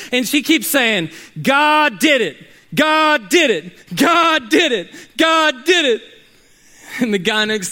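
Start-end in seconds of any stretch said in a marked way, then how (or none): 1.4-6.0 high, loud, ecstatic "God did it" chant; a woman shouting thanks to God at her doorstep; joyful praise. Acted. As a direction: proclaim it over and over in joy. Woman rejoicing over the groceries.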